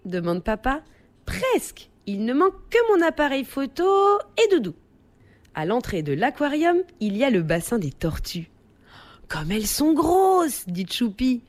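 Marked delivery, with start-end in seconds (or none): 1.3-4.7 put-on high-pitched eager child character; eager little child. A narrator's reading. Choupi answers papa in the story. 9.3-10.6 put-on high, wondering child voice; wide-eyed, high-pitched exclamation; child's amazement. A narrator's reading. Choupi marvels at the turtles.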